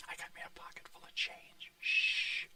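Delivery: hushed secret whisper. Pretend. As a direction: whisper it like a spy. Disguised guy hushing.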